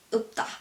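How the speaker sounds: 없다 as 업따, closed on a B stop; 없다 with only a B-like final; final sounds like B. Shown as a demonstration. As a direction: stop the first syllable of 없다 on B. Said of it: "it sounds like B".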